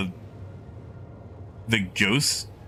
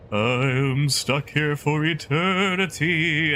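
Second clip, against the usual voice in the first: slow, drawn-out wail, pitched higher; spooky ghost voice; 4 semitones higher, slower than usual. Acted. New ghost bemoaning being stuck forever, spooky ghost wail. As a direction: stretch the vowels like a haunting movie ghost. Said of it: "a new ghost and they're like".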